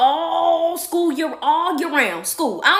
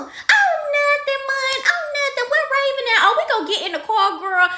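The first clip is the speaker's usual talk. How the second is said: high sing-song mock-innocent mom; breezy fake-casual mama, very high; mama brushing it off; 12 semitones higher than usual. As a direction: draw out the denial, pitched way up. Mother brushing off child, retold.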